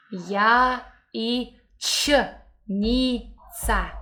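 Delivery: Я и Ч spelt slowly letter by letter; "Я… и… Ч", each letter apart; spelling it out. Shown as a demonstration. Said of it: "Do you see how we write it".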